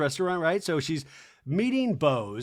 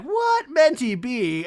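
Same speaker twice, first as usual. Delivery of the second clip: mock confusion; 7 semitones higher, 5 dB louder, slower than usual. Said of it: "And I was like".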